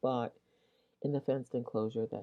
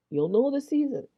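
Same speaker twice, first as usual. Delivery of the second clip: higher, louder, insistent repeat; emphatic; 11 semitones higher, 7 dB louder than usual. Real, not acted. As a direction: drive the point home on the repeat.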